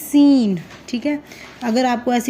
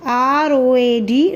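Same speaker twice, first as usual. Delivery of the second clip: slow spelling; much slower than usual. Shown as a demonstration. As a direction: spell R O A D letter by letter, slowly. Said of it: "teacher spelling letter by letter". (अक्षर-अक्षर धीरे से)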